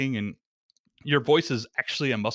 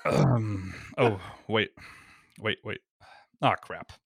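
flustered reenactment; 2 semitones lower, slower than usual. Shown as a demonstration. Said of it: "I'm gonna do a reenactment right here".

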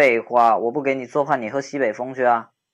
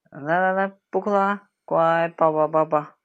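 higher, gentle coaxing to stop her crying; 5 semitones higher than usual. Acted. Friend coaxing crying girl to stop, tender coaxing. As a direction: soothe her like a crying child.

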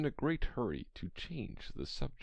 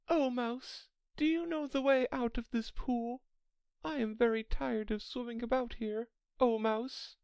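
high-pitched Alice, politely appealing; Alice addressing mouse. Acted. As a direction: address the mouse in a much higher voice.

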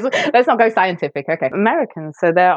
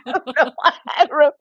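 after laughing hard; 11 semitones higher, faster than usual. Real, not acted.

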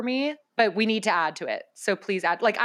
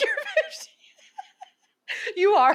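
laughing. Real, not acted. Teasing co-host, laughing.

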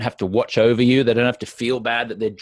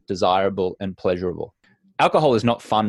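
ordinary talk

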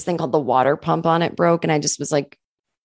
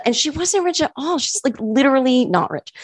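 usual speaking voice

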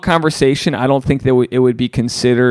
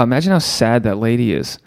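ordinary talk, nothing marked

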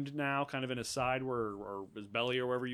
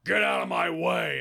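loud, raised goblin bellow; big goblin; 6 semitones higher, 10 dB louder than usual. Acted. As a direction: shove them aside with a big shout. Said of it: "so the big guy goes".

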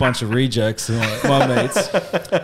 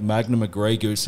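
usual speaking voice

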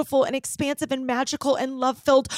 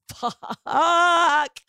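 loud, higher drawn-out "fuck"; exasperated curse; 6 semitones higher, 3 dB louder than usual. Retold or imitated. Client caught out by coach's answer, exasperated curse. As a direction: let out a long, frustrated swear.

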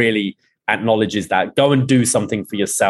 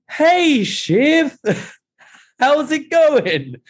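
very high, put-on mock greeting; awkward Tom; much slower than usual. Tom greeting Shiv after selling them out, awkward high mock voice. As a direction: imitate an awkward hello in a squeaky high voice. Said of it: "oh, it's so awkward".

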